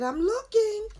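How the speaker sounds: high, loud whine; exasperated character. Acted. Character searching for his book.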